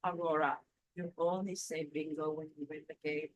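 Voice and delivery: softly and gently explaining; a kind neighbour's soft correction; gentle correction. Retold or imitated. Rosa gently corrects her about Bingo, soft and kind. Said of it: "put her hand on my arm like this very gently".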